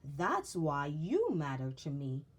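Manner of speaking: slow, tender declaration; a father telling his child he belongs; spoken very slowly. Acted. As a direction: let each word land gently. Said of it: "spoke very slowly".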